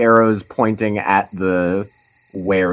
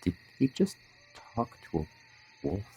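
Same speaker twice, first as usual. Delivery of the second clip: mumbling; 12 dB quieter, faster than usual. Acted. Lewis reacts to seeing someone talk to a wolf, mumbling. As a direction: mumble it under your breath, trailing softer. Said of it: "Lewis kinda mumbles".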